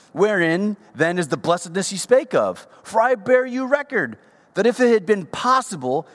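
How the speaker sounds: high, loud scripture reading; fervent preaching. Acted. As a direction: proclaim it to the church with fire. Preacher reading scripture at full force.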